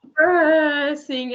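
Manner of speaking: drawn-out gloom. Real, not acted. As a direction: stretch the word out slowly and heavily. Dragging out how bleak January is.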